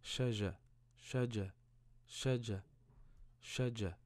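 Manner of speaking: shaja, sh then ja, open a vowels; syllable blending. Shown as a demonstration. Teacher building a word for a pupil. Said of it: "ma'al fatha".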